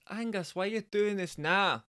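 high Bristolian woman's voice, practice take; attempted Bristolian woman. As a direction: have a go at a Bristolian wife. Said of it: "difficult for me to do a Bristolian women's accent".

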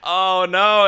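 high, loud mock dismay; retold crying dismay. Podcaster recalls starting to cry at the movie, mock dismay. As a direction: wail it a little, half laughing. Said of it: "then I start crying".

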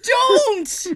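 high, loud, laughing pleading shouts; frantic plea. Retold or imitated. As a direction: beg him not to repeat it, shouting. Pleading with dad not to repeat.

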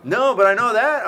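excited quoted reply. Retold or imitated. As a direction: bounce it up high and loud, eager to please. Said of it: "I'm like".

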